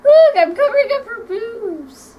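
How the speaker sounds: high voice through laughter; laughing. Real, not acted. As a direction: talk while cracking up. Laughing while covering doll.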